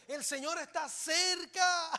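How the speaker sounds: slow, high, ringing shout; preaching shout. Acted. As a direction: proclaim it to the back row. Preacher proclaims Bible promise.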